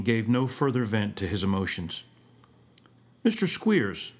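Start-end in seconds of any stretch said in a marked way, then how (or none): 3.2-4.1 lightly voiced high waiter's call; a hint of a tavern waiter. A narrator's reading. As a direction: call the gentleman politely from the door. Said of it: "said the waiter in the tavern".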